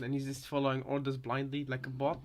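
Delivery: ordinary talk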